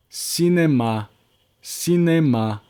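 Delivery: cinéma with the same force on every syllable; even syllable stress. Shown as a demonstration. Teacher showing students even French stress, even stress. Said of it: "a mesma força".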